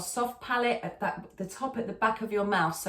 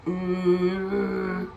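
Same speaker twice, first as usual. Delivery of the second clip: Kermit the Frog growl; 2 semitones lower than usual. Shown as a demonstration. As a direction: rattle the hum at the back of the throat. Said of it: "a kind of Kermit the Frog".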